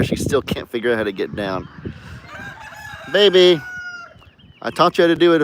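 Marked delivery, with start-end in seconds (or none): 3.1-5.4 calling out. Real, not acted. Calling the cat on the porch.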